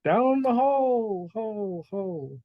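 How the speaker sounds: long, high call falling away in echoes; echoing call. Acted. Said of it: "it just, like, echoes".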